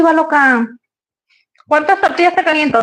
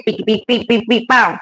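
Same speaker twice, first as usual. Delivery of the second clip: imitating dance beat; 4 semitones lower than usual. Shown as a demonstration. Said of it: "Que se hace".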